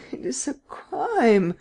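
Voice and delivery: high, slow, faltering moan. A narrator's reading. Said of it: "moaned Beaupré, faltering".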